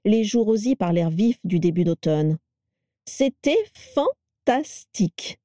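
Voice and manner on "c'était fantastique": high, slow, syllable-by-syllable rapture; radiant delight. A narrator's reading. Vera raving about her scooter ride, radiant. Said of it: "déclara-t-elle rayonnante".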